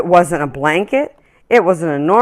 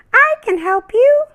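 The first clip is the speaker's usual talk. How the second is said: much higher and louder, a tiny bird chirping; tiny singsong voice; 7 dB louder than usual. Acted. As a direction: sing it like a tiny bird offering help. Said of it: "sang a tiny voice".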